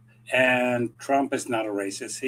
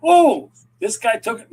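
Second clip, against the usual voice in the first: mock surprised voter; 9 semitones higher, 7 dB louder than usual.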